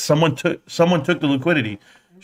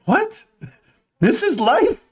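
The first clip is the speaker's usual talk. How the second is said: high, squeaky disbelief; mock shock; slower than usual. Husband hearing he must do chores, mock shock. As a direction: act stunned at the news. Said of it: "I was like".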